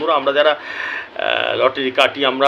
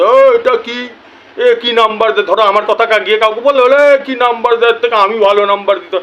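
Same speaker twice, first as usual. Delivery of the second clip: higher, louder sneering know-it-all voice; mocking a know-it-all; 6 semitones higher, 5 dB louder than usual. Mocking know-it-alls who scoff at his numbers, in a higher, louder sneering voice. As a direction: mimic a smug show-off scoffing at the numbers. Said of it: "বেশি বোঝা".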